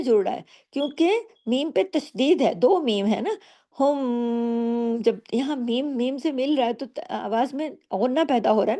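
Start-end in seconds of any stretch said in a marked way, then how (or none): 3.8-5.0 हुम with the meem hummed long through the nose; long ghunna. Shown as a demonstration. Teacher showing idgham shafawi, हुम hummed long. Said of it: "देर तक आवाज़ नाक में".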